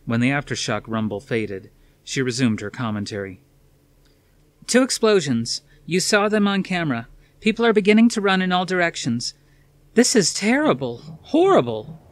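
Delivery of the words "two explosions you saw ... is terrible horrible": higher, louder reporter voice fighting to stay steady; live reporter at a disaster, shaken but broadcasting; shaken reporter on air. Acted. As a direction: deliver the news while horror creeps in. Reporter covering explosions on air.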